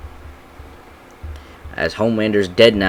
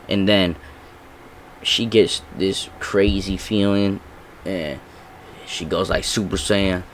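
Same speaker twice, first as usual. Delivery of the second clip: ordinary delivery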